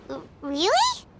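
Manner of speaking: stammered question swooping sharply upward; incredulous rising question; taken aback. Acted. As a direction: stumble on it, then rise in disbelief.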